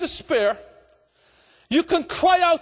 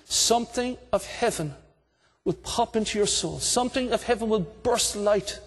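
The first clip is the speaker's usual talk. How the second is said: much lower, softer, intimate preaching; soft altar call; 8 semitones lower, 3 dB quieter than usual. Acted. Preacher gently inviting listeners to come to God, hushed and low. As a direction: speak gently, as if to one person.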